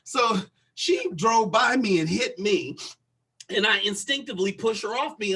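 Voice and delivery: usual speaking voice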